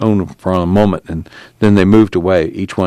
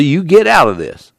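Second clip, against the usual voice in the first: higher, sharp command; 8 semitones higher than usual. A narrator's reading. Casey ordering Al to go in, sharp. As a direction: snap the order out. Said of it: "Casey said sharply".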